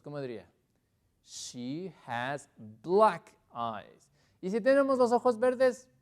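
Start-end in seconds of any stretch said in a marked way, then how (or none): 1.2-4.1 very slow, deliberate English; slow model answer. Shown as a demonstration. Teacher answering about eye colour, slow and deliberate. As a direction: space out each word clearly.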